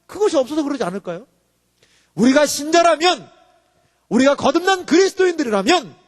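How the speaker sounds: high, forceful pulpit declamation; fervent sermon delivery; impassioned preaching. Real, not acted. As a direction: proclaim it, stretching the key words.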